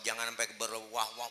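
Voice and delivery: usual speaking voice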